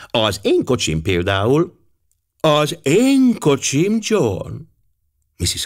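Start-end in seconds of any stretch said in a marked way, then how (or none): 2.4-4.5 high, drawn-out wifely correction; sharp, piercing. A narrator's reading. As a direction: cut in slowly and piercingly. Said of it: "hangja éles volt és átható". (éles, átható, elnyújtott hang)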